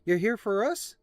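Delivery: put-on bright welcoming young lad; cheerful young Makoto. Young boy welcoming the party into the cart, high and eager. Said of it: "he looks at you and he says".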